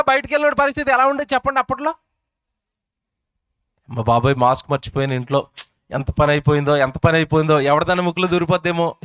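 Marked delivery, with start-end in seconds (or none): 3.9-9.0 a lower, panicky voice of a man who forgot his mask; panicked. Retold or imitated. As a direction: fret as if the mask was left at home. (Mask మర్చిపోయిన వాడి కంగారు, తగ్గిన స్వరంతో)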